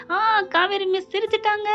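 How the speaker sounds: high, sing-out cheer of joy; jolly delight. Retold or imitated. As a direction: act out gleefully announcing it to everyone. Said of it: "அப்படின்னு சொல்லிட்டு ஜாலியா எந்திரிக்கிறாரு". (மகிழ்ச்சியில் குரலை உயர்த்தி ஆரவாரமாக)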